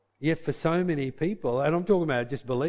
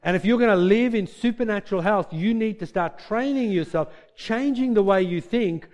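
normal talking voice

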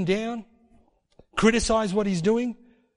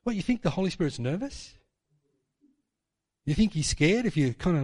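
ordinary talk

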